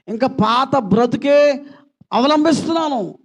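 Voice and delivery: thundering call to repent; fiery preaching. Acted. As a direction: preach repentance with full force. Pastor calls for surrender.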